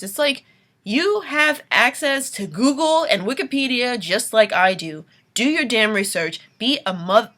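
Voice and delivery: loud, heated rant at allies; angry rant. Real, not acted. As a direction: let the frustration boil over. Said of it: "too late to be getting this angry".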